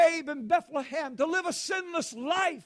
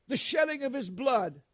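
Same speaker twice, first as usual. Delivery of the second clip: ordinary talk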